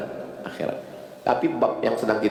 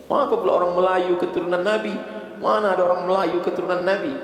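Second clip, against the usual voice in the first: mocking disbelief; 12 semitones higher than usual. Mocking rhetorical question in sermon.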